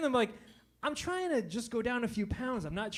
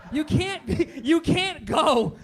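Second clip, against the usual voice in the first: loud slow rant; shouting; 5 semitones higher, 9 dB louder, slower than usual. Real, not acted. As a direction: hammer each word out.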